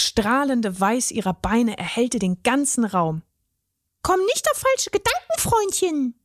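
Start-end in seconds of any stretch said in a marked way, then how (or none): 4.0-6.2 very high, protesting fairy voice; a tiny fairy putting him in his place. A narrator's reading. The fairy protests to the troll, high and indignant. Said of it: "protestierte sie".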